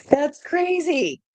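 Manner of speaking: high laughing, drawn-out delivery; laughing disbelief. Real, not acted. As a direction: laugh through it in amazement. Laughing at the card's match.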